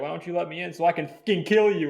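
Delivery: loud, high taunting threat; menacing threat. Retold or imitated. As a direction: sneer the threat at her. Killer threatens Christine.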